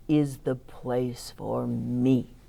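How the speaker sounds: a hint of a pleased lion musing to himself; teacher voicing the grinning lion in the story, gently; contented lion. A narrator's reading. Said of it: "thought the lion, grinning".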